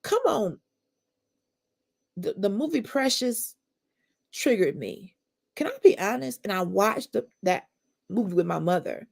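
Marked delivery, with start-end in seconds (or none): none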